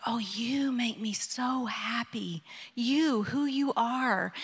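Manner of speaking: slow whispered reassurance; tender whisper. Retold or imitated. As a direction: whisper it like a loving father, unhurried. God's answer voiced tenderly.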